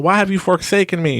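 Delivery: hammed-up biblical despair; mock anguished cry. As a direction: cry out to heaven, high and loud. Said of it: "he's freaking out".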